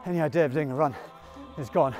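normal talking voice

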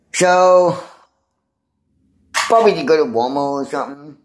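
ordinary talk, nothing marked

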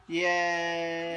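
happy loud yay; cheering. Real, not acted. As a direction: cheer for the end of the book. Cheering at story end.

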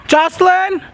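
high-pitched holler for someone; playful high yell; yelling a name. Pretend. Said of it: "I'm, like, yelling".

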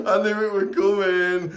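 high voice through real laughter; laughing. Real, not acted. Water has gone everywhere at bath time, laughing hard. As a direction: say it while cracking up. Cracking up after water splash.

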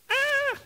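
sharp high yelp, soft; mock kid's cry. A kid getting swatted lets out a high yelp. Child swatted with paint stick.

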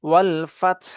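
"ফাতহ" ends on t and breathy h, no vowel; double sakin ending. Shown as a demonstration. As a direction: stop "ফাতহ" on both consonants together. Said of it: "দুইটা সাকিন একসাথে উচ্চারণ করব".

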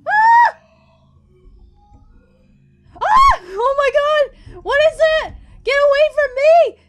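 high, loud panicked screams; terrified screaming. Real, not acted. Player fleeing a monster, screaming in terror. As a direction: scream for your life as it chases you.

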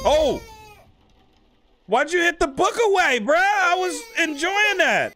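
very high, loud outburst of protest; outraged complaint. Real, not acted. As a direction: shriek in exasperation at being interrupted. Book knocked away mid-story.

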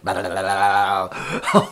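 low tongue-flapping babble; gecko lip-licking noise. Acted. Storyteller imitates gecko licking his lip, low tongue flapping. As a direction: flap the tongue rapidly, low.

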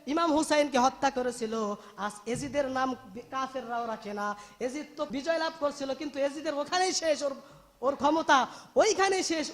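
ordinary delivery

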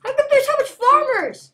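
startled gamer shout; surprised yell. Real, not acted. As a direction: yell it in disbelief at the screen. Surprised yell mid game.

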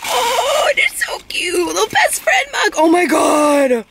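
high, drawn-out squeal of delight; delighted gushing. Real, not acted. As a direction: gush over an adorable gift. Gushing over a gift mug.

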